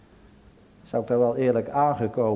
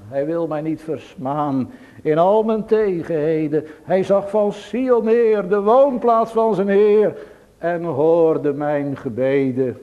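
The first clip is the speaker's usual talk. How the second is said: psalm words rising higher and louder, then slowing; psalm recited in a ringing, higher voice; rising psalm proclamation; 6 semitones higher, 7 dB louder than usual. Retold or imitated. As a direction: proclaim the psalm line with swelling fervour. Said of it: "dat ze zingende naar huis gegaan is".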